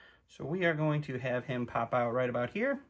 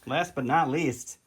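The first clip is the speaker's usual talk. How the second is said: slow, louder mock-epic build-up; grand announcer intro; 4 dB louder than usual. Player introducing his last unit, mock announcer. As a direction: announce him like a legend. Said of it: "the man, the myth, the god himself".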